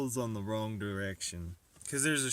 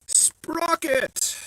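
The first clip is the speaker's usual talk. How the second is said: high, loud shouted Sprocket; excited shout; 9 dB louder than usual. Acted. Maker shouting as he finds a part, excited shout. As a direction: burst out with Sprocket like a discovery.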